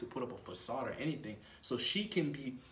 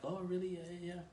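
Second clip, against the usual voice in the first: put-on high, soft girl's response; light, raised woman's voice, quiet; interested girl; 6 semitones higher, 4 dB quieter than usual. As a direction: imitate her nodding along, pitched up. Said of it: "She's like".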